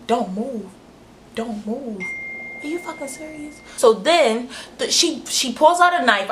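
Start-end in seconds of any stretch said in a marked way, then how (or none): 0.1-3.6 retold hushed warning. Retold hiding from intruder.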